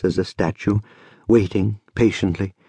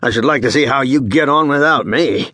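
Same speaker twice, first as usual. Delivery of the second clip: higher-pitched, self-important huff; mildly worked-up boasting; excited; 4 semitones higher than usual. A narrator's reading. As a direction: show off indignantly before a crowd. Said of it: "becomes even more excited".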